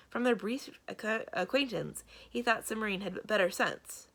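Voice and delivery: ordinary delivery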